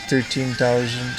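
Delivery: ordinary talk, nothing marked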